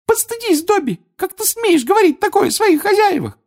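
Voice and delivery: loud, very high elf scolding; piercingly high house-elf telling-off; indignant Winky. A narrator's reading. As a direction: shame the other elf in a furious squeak. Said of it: "гневно пискнула Винки".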